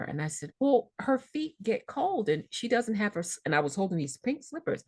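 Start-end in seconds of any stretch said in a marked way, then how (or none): 0.6-3.4 put-on childlike plea. Daughter pleading like a child.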